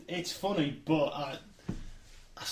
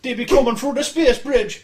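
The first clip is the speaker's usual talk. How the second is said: put-on South African accent; 8 semitones higher, 11 dB louder than usual. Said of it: "my terrible South African accent".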